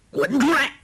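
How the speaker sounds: high barked shout; shouted order. A narrator's reading. Villain shouts at the hidden blind man, a high angry roar. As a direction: roar him out of hiding. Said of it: "喝道".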